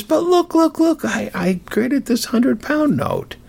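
very high, urgent insistence; excited pleading. Acted. Apprentice pressing the master about his note, excited pleading.

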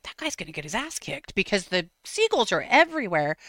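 usual speaking voice

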